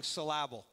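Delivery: "syllable" as suh-LAB-ul, stress on the middle; syllable mis-stressed. Shown as a demonstration. Preacher's running joke on emphasis, "syllable" said suh-LAB-ul. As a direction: punch the second beat of "syllable", suh-LAB-ul. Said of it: "emphasize the one part of the word".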